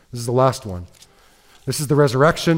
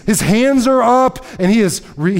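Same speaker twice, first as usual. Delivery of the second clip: ordinary delivery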